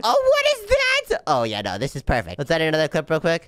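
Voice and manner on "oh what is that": high, drawn-out falsetto character; Mia. Acted. Voicing the scared girl during playback, slow high squeal. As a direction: squeal slowly as the frightened girl. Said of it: "And she's scared".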